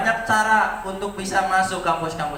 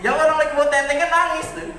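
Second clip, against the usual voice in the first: shocked exclamation; 11 semitones higher, 3 dB louder than usual. Retold or imitated. Father retells his shocked reaction.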